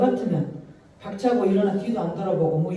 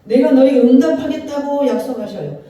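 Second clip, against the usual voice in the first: higher, louder proclamation; preaching climax; 4 semitones higher, 6 dB louder than usual. Real, not acted. Preacher proclaiming God's promise, loud.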